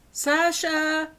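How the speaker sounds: high, loud call for the cat. A narrator's reading. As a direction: call the cat across the yard. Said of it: "she called".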